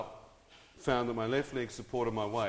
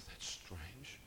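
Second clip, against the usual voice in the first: quiet puzzled; 4 semitones lower, 14 dB quieter than usual. Retold or imitated. Puzzled inner thought.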